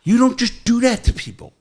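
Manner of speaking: high, incredulous and indignant; indignant outburst. Real, not acted. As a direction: throw the word back, offended. Outraged at being handcuffed.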